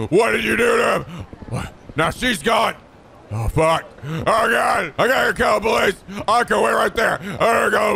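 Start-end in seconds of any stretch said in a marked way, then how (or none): none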